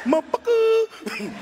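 high, slow, slurred motherfucker; drawn-out high voiced cuss; brother impression. Brother cursing from the yard, high and slow.

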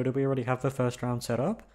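usual speaking voice

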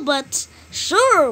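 normal talking voice

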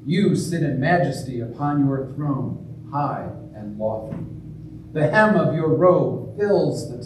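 solemn intoned eucharistic prayer; liturgical prayer. Acted. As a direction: pray it aloud with reverent weight.

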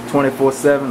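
ordinary talk, nothing marked

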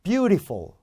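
Beautiful with a clear "ri" middle, pitched high; deliberately flawed pronunciation. Shown as a demonstration. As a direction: say Beautiful as "byu-ree-fol". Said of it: "뭘 틀리나 잡아보세요".

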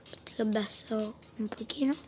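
soft whisper so mum sleeps. Real, not acted. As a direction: whisper so no one wakes. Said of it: "Mia madre che sta dormendo".

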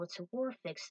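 ordinary delivery

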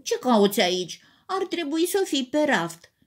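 a touch of annoyance, rising high at the end; cross teddy bear. A narrator's reading. Teddy bear tells the story book off, mildly cross. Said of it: "răspunse supărat".

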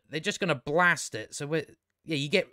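usual speaking voice

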